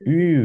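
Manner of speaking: ইউ held long and light; drawn-out ü. Shown as a demonstration. Teacher modelling German ü for a learner. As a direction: stretch ইউ gently. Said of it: "একটু টেনে বলবেন, হালকা করে".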